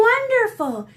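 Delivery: high, loud amazed cry; delighted. Acted. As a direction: gasp out the wonder. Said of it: "said Big Anthony".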